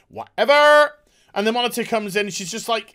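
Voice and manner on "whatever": high, loud scornful shout; dismissive yell. Pretend. Reviewer dismissing a feeble excuse, loud scornful yell. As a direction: throw it out loud in exasperation.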